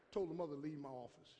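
ordinary talk, nothing marked

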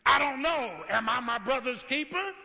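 angry Cain. Acted. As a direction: snap back defiantly. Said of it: "look how angry he is".

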